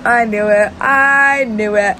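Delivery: loud, slow gloating; triumphant. Real, not acted. Fan confirming a theory, loud and slow. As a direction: drag it out, vindicated.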